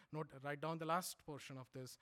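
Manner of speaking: ordinary delivery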